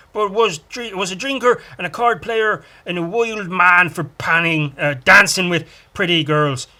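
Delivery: broad mock-Irish storytelling lilt; bad Irish accent. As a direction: ham up a terrible Irish accent. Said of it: "this should all be done in an Irish accent".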